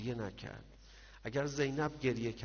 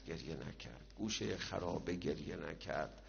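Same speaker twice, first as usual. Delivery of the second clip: گریه نکرد dropped much lower and softer; faded near-whisper; 6 semitones lower, 8 dB quieter than usual. A narrator's reading. Preacher repeating that Zeinab did not weep, fading to almost nothing. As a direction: let گریه نکرد fall away almost silent. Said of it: "repeated phrase, second fades".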